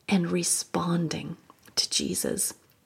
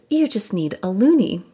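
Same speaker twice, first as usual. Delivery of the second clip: bright, breezy reassurance, a bit louder; chipper; 2 semitones higher, 6 dB louder than usual. Retold or imitated. Telling the stranger at the store, chipper and casual. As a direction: make it sound like no big deal. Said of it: "I tried to sound chipper".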